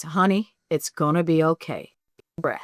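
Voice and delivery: soft, soothing mentor voice; quietly calm down an anxious newcomer; softly retold comfort from a kindly manager; gentle reassurance.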